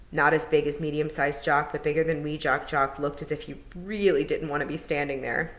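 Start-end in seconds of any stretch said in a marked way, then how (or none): none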